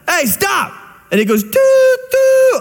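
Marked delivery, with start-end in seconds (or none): 0.1-0.7 yelling at train. Retold or imitated. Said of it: "he yells at it". 1.4-2.6 higher, louder hooting train horn, closer now. Retold or imitated. Preacher imitates the train horn getting louder as it nears the man. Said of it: "a little louder".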